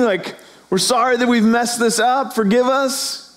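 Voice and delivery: the elders begging God to forgive them; panicked pleading. Acted. Elders voiced, panicked repentance.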